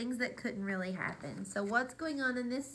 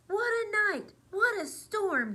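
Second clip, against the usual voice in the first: high, excited little mole; 10 semitones higher, 5 dB louder than usual. Acted. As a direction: exclaim about the storm. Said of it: "Mole mutters".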